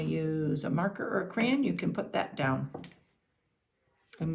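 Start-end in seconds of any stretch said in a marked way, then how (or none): none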